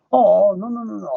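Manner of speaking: high, rushed no no no; mock shocked denial; indignant denial. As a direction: wave it off in disbelief. Denying vulgarity.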